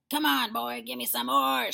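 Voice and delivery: high, loud, put-on old woman; demanding yell. A narrator's reading. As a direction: yell impatiently for it. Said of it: "she yelled".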